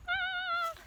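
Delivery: sudden high squeak; a quick startled yelp; high squeak of surprise; surprised. Real, not acted.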